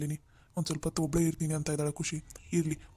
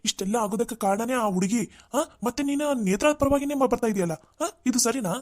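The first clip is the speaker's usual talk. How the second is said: high-pitched, indignant protest; agitated protest; 6 semitones higher than usual. Acted. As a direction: plead with her in rising frustration. Parent protesting to daughter. (ಕೋಪದಿಂದ ಏರಿದ ಧ್ವನಿಯಲ್ಲಿ ಪ್ರತಿಭಟನೆ)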